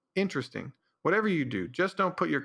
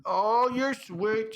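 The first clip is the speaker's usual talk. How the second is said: high, slow coo; cooing pet voice; 7 semitones higher, much slower than usual. Real, not acted. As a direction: melt over the cat. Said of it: "I talk like that 'cause it annoys my girlfriend".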